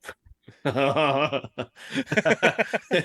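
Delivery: high loud burst of laughter. Real, not acted. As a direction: let out a big real laugh. Laughing at Newcastle accent.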